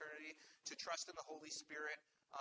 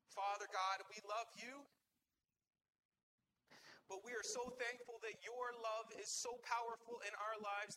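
prayer; 7 semitones higher, 4 dB louder than usual. Real, not acted. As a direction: pray aloud over the congregation with conviction. Leads a congregational prayer.

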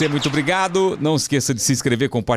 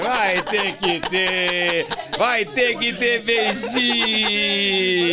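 high, long drawn-out singsong call; teasing cheer; 7 semitones higher, much slower than usual. Real, not acted. Host demands a kiss from the couple to close, teasing singsong cheer. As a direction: stretch every vowel, egging them on. Apresentador pede beijo do casal.

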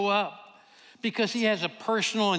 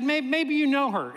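stammered start, then higher and louder; sheepish eager blurt; 7 semitones higher, 3 dB louder than usual. Retold or imitated. Preacher to Leah, embarrassed but pushing on. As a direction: push on though it feels stupid. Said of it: "I felt so stupid".

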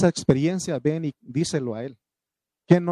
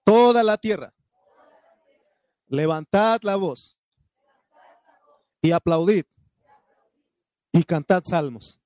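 loud call; 4 semitones higher, 3 dB louder than usual. Acted. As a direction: proclaim loudly, leading the congregation. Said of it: "diga conmigo".

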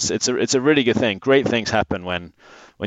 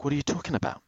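retold disbelief; 8 dB quieter, faster than usual. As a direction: replay being stunned, keeping it soft. Retells his stunned reply to brewer.

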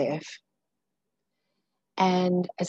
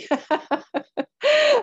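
high burst of laughter; 6 dB louder than usual. Real, not acted. Laughs at swallowing a bug.

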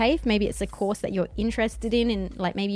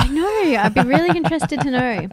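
mock eager; 6 semitones higher, 8 dB louder than usual. Mock eager reaction to divorce talk.

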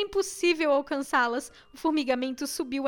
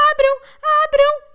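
high, loud repeated cry; shout; 11 semitones higher, 11 dB louder than usual. A narrator's reading. Trapped girl demanding they open up, high shout. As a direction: shout it twice, demanding to get out. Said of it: "eu gritei".

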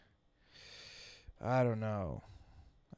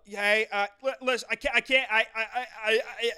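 stuttering stern dad; 6 semitones higher than usual. Retold or imitated. Dad trying to correct son, stuttering with contained anger. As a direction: stutter on I while masking anger. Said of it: "They stutter on I".

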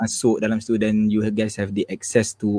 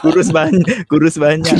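laughing; 5 semitones higher, 5 dB louder, slower than usual. Real, not acted. Teasing reply about being thinner.

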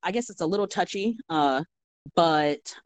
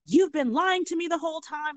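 retold blow-up; 12 semitones higher than usual. Salad customer accuses her server of lying, mock angry outburst. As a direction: imitate an angry customer, pitch jumping right up. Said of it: "she fucking blew up on me".

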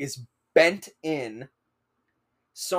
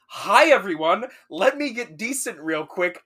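raised-pitch, louder public greeting; breezy awkward greeting; 5 semitones higher, 6 dB louder than usual. Retold or imitated. Student in boxers greeting officers on couch, awkwardly chipper. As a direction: greet the room with put-on brightness.

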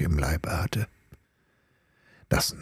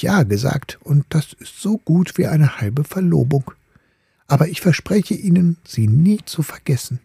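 lightly voiced ball, a bit higher, first words whispered; gentle refusal; 6 semitones higher than usual. The ball refusing the top for the swallow, lightly coy.

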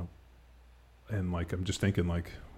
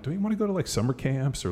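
much higher, sing-song coaxing question; mock coaxing dad; 8 semitones higher than usual. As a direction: tease her toward summer camp. Dad quoting himself to daughter.

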